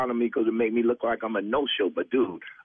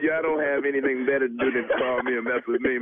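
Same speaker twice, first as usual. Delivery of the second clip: ordinary delivery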